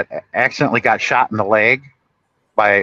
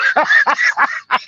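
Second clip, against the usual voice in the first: laugh. Real, not acted. Laughing at the story.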